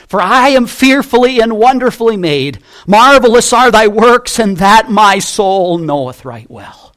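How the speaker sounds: rising proclamation of praise; soaring scripture reading. Acted. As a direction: lift the psalm up with full voice. Preacher swells reading the psalm.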